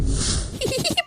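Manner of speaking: quick, high, breathy laugh; a teasing little cackle; high mocking giggle. The friend giggles at Disha's scientist dream in a high, teasing voice.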